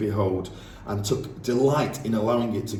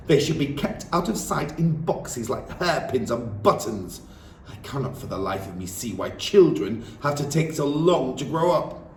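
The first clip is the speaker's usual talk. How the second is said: disdainful headmistress; 5 semitones higher than usual. Acted. Headmistress sneering at children before the class. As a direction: lay down the law.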